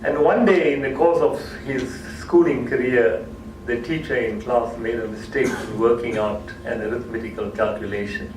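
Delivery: usual speaking voice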